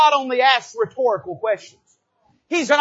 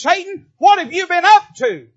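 higher, louder demanding call to Satan; God demanding; 7 semitones higher, 7 dB louder than usual. Retold or imitated. Preacher voicing God calling Satan out, loud and high. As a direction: ring it out like a summons. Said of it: "God looks at Satan and says".

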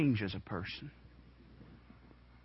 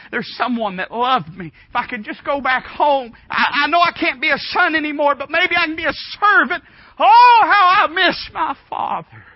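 builds from pleading to a high loud wail; rising anguished plea; 12 semitones higher, 10 dB louder than usual. Retold or imitated. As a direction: cry out for the father, swelling. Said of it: "he says".

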